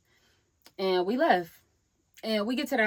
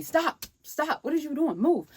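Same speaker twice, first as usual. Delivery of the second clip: retold annoyance; 7 semitones higher than usual. Retells snapping at Rome pushing her.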